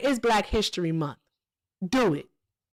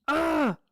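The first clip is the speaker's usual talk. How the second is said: high exasperated ugh; frustrated groan; 6 semitones higher than usual. Real, not acted. Streamer fed up with chat, a high groan. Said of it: "I wanna scream so bad".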